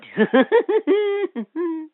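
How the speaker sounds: a bright high electronic giggle; high toy giggle. Acted. Toy robot giggling at the child, high giggle.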